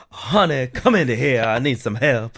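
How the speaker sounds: hammed-up biscuit-and-gravy voice. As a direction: call your honey over with a Southern lilt. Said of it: "I always do my [name] impersonation".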